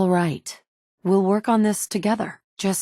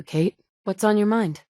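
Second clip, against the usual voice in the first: ordinary talk, nothing marked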